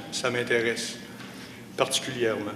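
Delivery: normal talking voice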